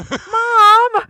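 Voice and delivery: very high, very loud holler; drawn-out mock scream for mother; imitated scream.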